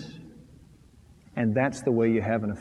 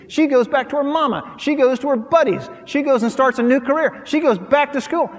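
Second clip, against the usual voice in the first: louder and higher, driving the point home; preaching at full force; 8 semitones higher, 5 dB louder than usual. Acted. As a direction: preach it hard to the congregation.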